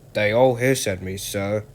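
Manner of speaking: usual speaking voice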